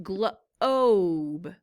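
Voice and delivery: "globe" drawn out slowly with a long O; slow stretched globe. Shown as a demonstration. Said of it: "I hear the O in it".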